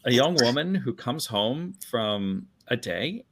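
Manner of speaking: usual speaking voice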